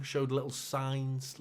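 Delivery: "little" drawn out slowly and leaned on; slow, weighted "little signs"; stress on little. Shown as a demonstration. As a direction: lean hard on "little". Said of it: "I stress the word little".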